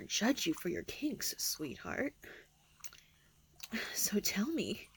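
soft breathy whisper. Acted. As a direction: whisper it close and teasing. Seductive whisper.

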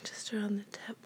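ordinary talk, nothing marked

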